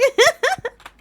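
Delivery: high-pitched burst of laughter; loud laugh. Real, not acted. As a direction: crack up at yourself, loudly. Laughs at own aggravation.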